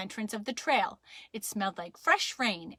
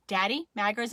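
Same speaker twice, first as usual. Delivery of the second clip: ordinary delivery, nothing marked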